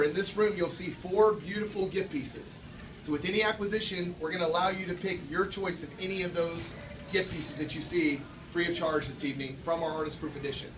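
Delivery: ordinary talk, nothing marked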